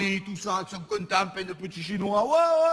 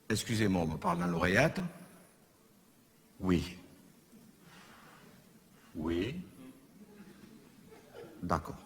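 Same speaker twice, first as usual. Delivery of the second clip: aparté oreillette; 9 semitones lower, 6 dB quieter than usual. Pretend. Fait semblant d'écouter son oreillette.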